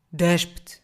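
loud looming monster command; voz ameaçadora. A narrator's reading. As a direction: order it like a beast. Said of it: "uma voz ameaçadora". (ordem de monstro ameaçadora)

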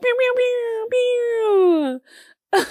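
high-pitched laser pews; slow, squeaky pew sounds. Pretend. Playful sound effect.

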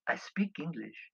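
put-on amused hotelier with a French accent; smirky. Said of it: "said with a sincerely but smirky smile".

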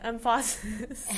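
"Emphasis" slowly, stressed as em-FA-sis; wrong-syllable stress. Pretend. Co-host joking about emphasis, slow em-FA-sis. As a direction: lean on the middle syllable of "Emphasis". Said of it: "Emphasis on the wrong syllable".

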